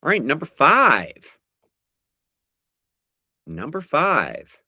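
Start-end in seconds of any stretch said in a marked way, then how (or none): none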